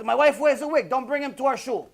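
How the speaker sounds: hammed-up rabbi refusing the speaker; indignant rabbi, imitated with exaggeration; imitating the rabbi. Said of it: "The rabbi".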